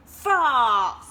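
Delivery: very loud angry yell. Acted. Duck yelling at the diving frogs, very loud. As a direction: bellow their name. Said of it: "Duck yelled".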